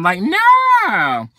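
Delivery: high, loud sassy refusal; emphatic no. Real, not acted. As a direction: shut it down hard, no way. Rejecting the ex, emphatic.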